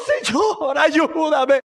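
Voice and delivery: high, quavering desperate begging; acted sobbing plea for help; tearful pleading. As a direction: beg as a mother in tears. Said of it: "pero con lágrimas de los ojos".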